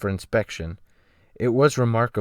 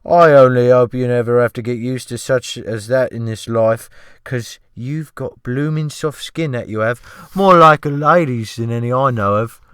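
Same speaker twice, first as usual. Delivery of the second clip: fawning sea cook, pitched up and loud; ship's cook; 2 semitones higher, 7 dB louder than usual. Acted. Cook mocks his soft skin.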